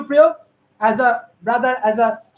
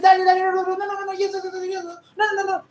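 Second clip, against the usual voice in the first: high monotone babble, falling softer; high-pitched, half-hearted imitation of shouting; mock shouting; 4 semitones higher, 4 dB quieter than usual. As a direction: imitate a shouter with a stream of repeated words. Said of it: "shouts like this".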